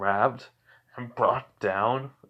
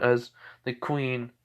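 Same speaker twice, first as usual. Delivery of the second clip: ordinary delivery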